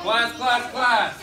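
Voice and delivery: teacher's attention call; calling the class. Acted.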